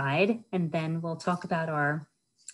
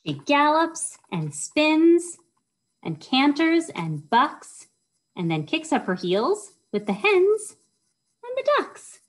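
lively storytime reading; 10 semitones higher, 5 dB louder than usual. Acted. Librarian acts out the pony's gallop for kids, excited storytime voice. Reads lively rhyme lines aloud.

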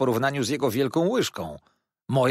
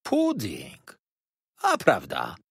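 mildly astonished waiter; lightly voiced surprise, dropping afterwards; exclaiming; 2 semitones lower, much slower than usual. A narrator's reading. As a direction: repeat it as a small discovery. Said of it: "zawołał".

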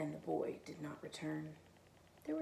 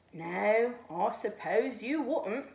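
slow, louder resigned cat; sighing cat; 3 semitones higher, 8 dB louder, much slower than usual. A narrator's reading. Cat answers the aloof snowman, slow and resigned. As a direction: sigh it out, weary stray. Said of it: "said the cat with a sigh".

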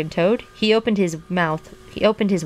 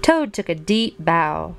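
ordinary delivery

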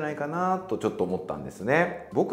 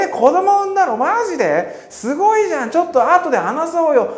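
much higher and louder, squealing with a laugh; thrilled surprise; 8 dB louder than usual. Pretend. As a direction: gasp with joy at the news. Reacting to baby news. (出産の知らせに驚いて歓声を上げる高い声)

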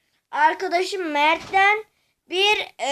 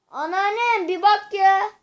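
calling out; 4 semitones higher, 4 dB louder than usual. Real, not acted. Calls grandma about machine.

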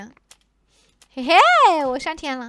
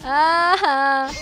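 fake crying; 3 semitones lower, much slower than usual. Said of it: "我要哭起来了".

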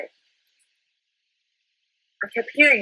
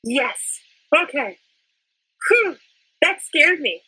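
normal talking voice

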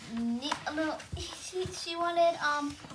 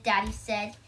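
ordinary delivery, nothing marked

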